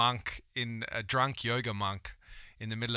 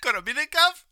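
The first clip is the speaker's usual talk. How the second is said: much higher, louder Aussie pitch; cheery fundraiser hook; street fundraiser; 13 semitones higher, 6 dB louder than usual. Retold or imitated.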